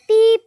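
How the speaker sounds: high, loud "পিপ" like a phone button; phone beep. Pretend. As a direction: chirp it like a toy phone. Hangs up pretend phone.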